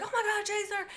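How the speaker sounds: high, squealing mock panic; Everybody mock-screams at Chaser, high and squealing.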